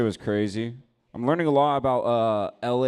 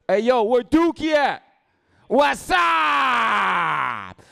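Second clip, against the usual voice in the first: much higher, louder hammed-up yell; mock shout; 9 dB louder, much slower than usual. Picturing his hometown fight, much higher mock yell. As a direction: bellow it like a hometown brawl.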